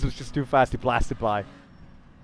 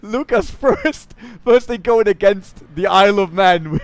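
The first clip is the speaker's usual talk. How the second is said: normal talking voice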